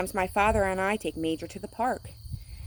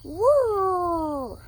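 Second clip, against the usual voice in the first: high, louder whir; tire whir; 12 semitones higher, 6 dB louder than usual. A narrator's reading. Reading to children, high tire whir. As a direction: squeal it round the corner. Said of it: "the tires go".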